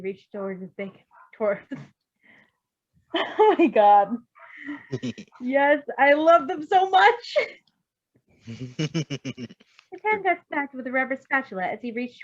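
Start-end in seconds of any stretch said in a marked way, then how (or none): 1.3-7.4 real laughter, much louder and slow; laughing. Real, not acted. Reader breaks into laughter. 8.5-12.2 slower, louder, still giggling; laughing through it. Real, not acted.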